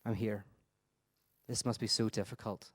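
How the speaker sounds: sit with a dying person and comfort them quietly; a pastor's hushed, steady comfort; gentle reassurance. Shown as a demonstration. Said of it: "You put your hand on their shoulder and you say".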